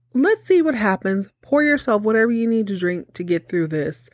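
ordinary talk

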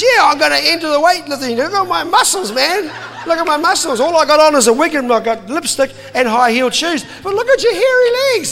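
high, loud laughing bluster; a mate jokingly showing off his muscles, loud and laughing. Retold or imitated. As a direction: boast through your laughter. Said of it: "And Mark says".

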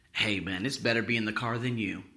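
imitated low, soft, easygoing voice; calm reassurance. Professor to daughter after the wreck, calm reassurance. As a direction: drop low and shrug it off gently.

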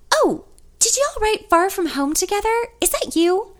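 high, thrilled Southern voice; excited Southern receptionist. A narrator's reading. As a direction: gush with delighted surprise. Excited receptionist recognizes song.

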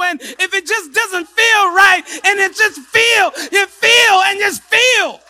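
loud, high, rising preacher's cry; preaching at full force. Acted. Preacher shouting at the congregation, full force. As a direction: build the shout to the peak.

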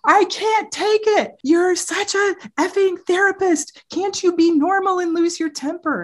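a hammed-up teen begging mom to lose it; imitating desperate teen. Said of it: "And he goes".

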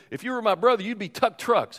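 high, delighted joking voice; amused drummer. Retold or imitated. Drummer joking with the boy about his name, high and delighted. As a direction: play the punchline like the funniest thing ever. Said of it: "He said".

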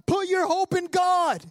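high, slow exhortation to your own soul; preacher's high-pitched command; prophesying to himself. Acted. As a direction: proclaim it high and drawn out. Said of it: "he's prophesying to himself".

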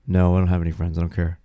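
low, loud mimicked brush-off; dropped-pitch retold indifference; sullen daughter. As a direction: imitate a kid killing the interview. Daughter's sullen answer, imitated.

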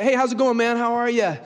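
high, loud, hearty hello; bubbly, high-pitched acted greeting; cheery greeting. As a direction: greet a pal breezily at full volume. Retold breezy greeting to a friend.

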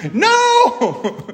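very high, loud, drawn-out mock yell; hammed-up squeal of protest; imitated yell. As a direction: mimic a girl's horrified protest, half laughing. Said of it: "yelling at me".

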